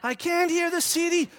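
put-on high-pitched whining boy; mock a small boy's repeated holler; imitated child yelling. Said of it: "all he's doing is screaming this".

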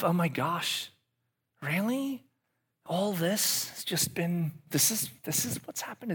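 high, startled realization; hammed-up alarm; mock shock. Acted shocked self-realization.

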